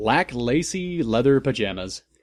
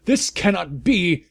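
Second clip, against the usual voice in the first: raised, higher voice, a shout; shouted angrily; 6 semitones higher than usual. A narrator's reading. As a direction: Umbridge shouting at the headmaster, angry shout. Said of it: "she shouted angrily".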